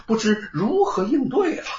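ordinary delivery

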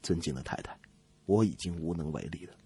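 very low, hushed resigned doctor; sighing resignation. Acted. Doctor telling the wife nothing can be done, resigned. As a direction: give up quietly, after a sigh. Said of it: "医生叹了口气".

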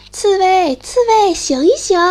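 loud, high shout; calling out. Acted. The mole shouts for the sleeping hedgehog. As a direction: shout across to wake him.

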